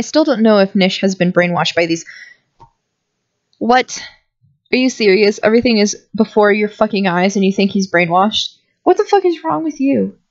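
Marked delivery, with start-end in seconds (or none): 3.6-10.2 disbelief boiling over into anger; outraged. Acted. Outraged at the brainwashing doubt.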